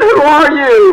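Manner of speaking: high, loud frantic call; crying out. Acted. Man on cliff questions the big voice, crying out. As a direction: shout up in comic alarm. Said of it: "he cries out to God".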